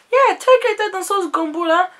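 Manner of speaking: high, loud Ivorian auntie, indignant. Retold or imitated. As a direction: play the baffled auntie, pitch way up. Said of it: "And she was like".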